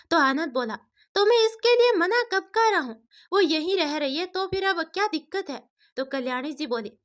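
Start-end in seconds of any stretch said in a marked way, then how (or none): 1.2-5.6 son arguing back, voice raised high; irritated son. A narrator's reading. Son to mother, irritated and high-pitched. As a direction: snap back impatiently at mother.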